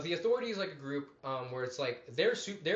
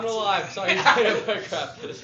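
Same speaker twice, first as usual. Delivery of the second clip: loud, high joking correction; loud mock apology; 6 semitones higher, 11 dB louder than usual. Host correcting a word to co-hosts, loud mock apology. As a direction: blurt it high and loud, dragging the end.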